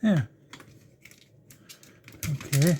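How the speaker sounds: ordinary delivery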